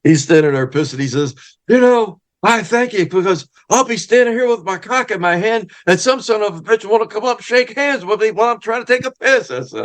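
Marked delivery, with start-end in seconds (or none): 1.7-9.5 much higher imitation of old Freddie, gleeful and crude; Freddie's voice. Freddie thanking his escort at the urinal, in a high crude mock voice. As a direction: ham up the old wrestler's grumbling thanks. Freddie explains while pissing, laughing.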